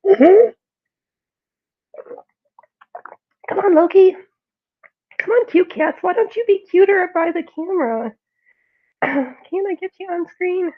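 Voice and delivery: high, loud sing-song pet talk; coaxing cats. Real, not acted. Owner coaxes cats Loki and Freya toward the camera, high and loud. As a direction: call the cats over in a high, sweet coax.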